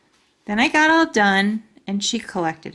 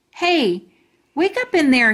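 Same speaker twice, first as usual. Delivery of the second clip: calling; 7 semitones higher, 4 dB louder than usual. Acted. Boy shouting at jar.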